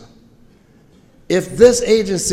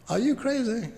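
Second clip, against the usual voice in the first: higher, softer, incredulous "Are you crazy?"; mock disbelief; 10 semitones higher, 6 dB quieter than usual. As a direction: pretend they gasped at the idea. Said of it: "They didn't say it like that".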